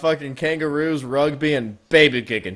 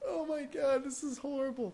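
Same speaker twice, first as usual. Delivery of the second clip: high, faint, spent voice; weak from laughing; 10 semitones higher, 15 dB quieter than usual. Real, not acted. Co-host appalled by the baby joke, weak from laughter. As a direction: softly gasp it out after laughing.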